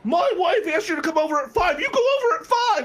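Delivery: high, loud mock sobbing plea; hammed-up tearful demand; imitating crying on phone. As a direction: wail it like a grown man crying down the line. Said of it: "And he's crying on the phone".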